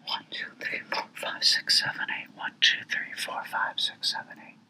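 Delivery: barely audible whispered count. Real, not acted. Narrator counting to himself in the session, whispered under his breath. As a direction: count under your breath, almost silent.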